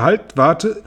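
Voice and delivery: hohes, scharfes Halt; Befehl. A narrator's reading. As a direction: gebietet Einhalt. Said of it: "befahl Tante Zinke". (hoher Befehlsruf)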